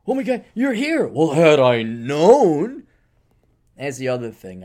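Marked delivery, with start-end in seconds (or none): 0.1-1.1 higher, louder mock gasp of a caught-out host; hammed-up surprise. Mocking startled host. 1.1-2.8 slow, louder huffy complaint; very slow, pointed mock grievance. Mocking annoyed host.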